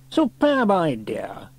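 high, drawn-out, falling approval; hearty approval. A narrator's reading. Station officer approving the new weather vane, hearty. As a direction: relish the idea, stretching both words.

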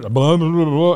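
loud run-together nonsense blahs; imitated garbled speech. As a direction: mimic incoherent babbling between lucid moments. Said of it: "moments of lucidity, like, where I could".

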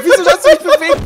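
a real laugh breaking into the complaint; laughing protest. Real, not acted. Laughing at a teammate after a silly in-game death.

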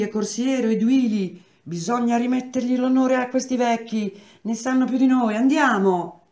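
breathless excitement. Acted. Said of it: "col fiato grosso".

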